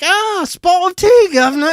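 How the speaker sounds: hammed-up English accent, thrown back as a taunt; mock English accent. Mocks Englishman's origins in retold comeback.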